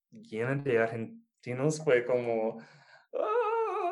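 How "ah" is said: overwhelmed groan. Retold or imitated. Overwhelmed by house of Argentines.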